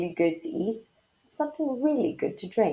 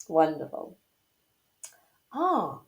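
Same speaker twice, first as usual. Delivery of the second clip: ordinary delivery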